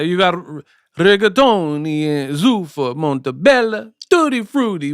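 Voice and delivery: hammed-up Italian chef voice; singsong mock Italian, rising high; fake Italian accent. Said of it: "get this fake Italian sounding".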